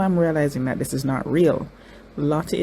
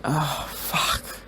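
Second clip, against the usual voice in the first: breathy whispered oh fuck under the breath; a stunned whispered curse; 6 dB quieter than usual. Real, not acted. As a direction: let it slip out as a whisper. Overwhelmed by emotion while watching.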